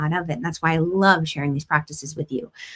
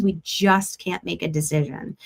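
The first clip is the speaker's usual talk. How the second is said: ordinary talk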